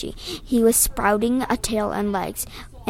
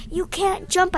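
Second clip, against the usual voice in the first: high, restrained scream; 9 semitones higher than usual. A narrator's reading. Narrator warning Nick off the jump, restrained scream. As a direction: scream a warning, held back. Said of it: "I screamed".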